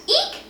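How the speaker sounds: very high startled shriek; mother bird shrieking in alarm; shrieked eek. A narrator's reading. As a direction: squeal in sudden fright. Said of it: "she cried".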